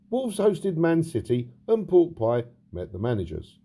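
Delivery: ordinary talk, nothing marked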